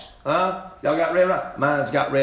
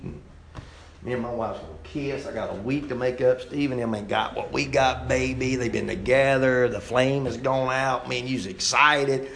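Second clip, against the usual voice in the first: usual speaking voice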